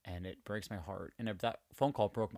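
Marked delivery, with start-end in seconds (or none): 0.1-1.1 soft, half-whispered, heartbroken; real emotion, voice sinking; getting emotional. Real, not acted. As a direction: let it drop to a near whisper as it gets to you. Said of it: "I'm getting emotional talking about it".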